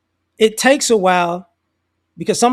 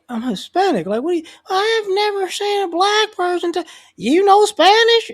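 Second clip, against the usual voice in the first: incredulous lady voice; 11 semitones higher than usual. Retold or imitated. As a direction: squeak it out as the stunned old lady.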